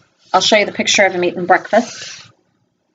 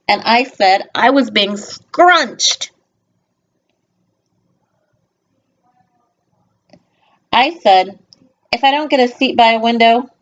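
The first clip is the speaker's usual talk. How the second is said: storybook reading; 4 semitones higher than usual. A narrator's reading. Reads picture book aloud.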